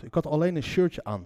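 ordinary talk, nothing marked

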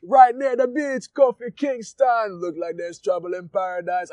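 put-on slow, high Jamaican accent; his old Jamaican catchphrase voice. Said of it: "my Jamaican accent is terrible".

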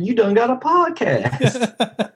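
high, slow Southern mama voice; imitating mama. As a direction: squeal it like a proud mama. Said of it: "My mama said".

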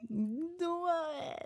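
ordinary talk

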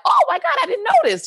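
very high, quick gush of surprise; high-pitched amazed exclamation; retold shock. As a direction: act out being astonished by big news. Retells her reaction to the news.

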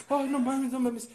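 muy agudo y fuerte, asustado; espantado. Retold or imitated. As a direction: grita del susto al ver el monstruo. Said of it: "Y tú". (espantado en la casa de sustos, agudo)

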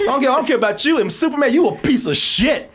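high, laughing rude villain; villain guinea pig. Retold or imitated. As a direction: sneer it with a laugh. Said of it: "guinea pig came in and said".

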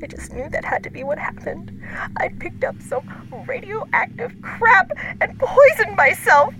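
frantic, rising whisper; breathless frightened whisper; panicked whisper. A narrator's reading. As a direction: whisper in mounting terror.

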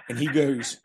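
normal talking voice

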